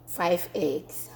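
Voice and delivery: normal talking voice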